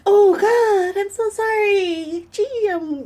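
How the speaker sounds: high, overdone pity voice with a crying edge; mock sympathy. Mimicking acquaintances who gush over her loss, high and teary. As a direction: play the gushing sympathetic stranger. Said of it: "people are like".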